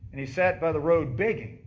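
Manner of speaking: ordinary talk, nothing marked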